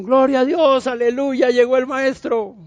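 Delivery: play-acted joyful praise; mock rejoicing. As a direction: cheer like an exultant congregation, hammed up.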